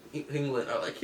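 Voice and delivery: ordinary talk, nothing marked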